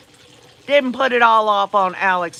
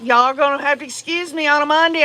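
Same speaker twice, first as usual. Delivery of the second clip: ordinary talk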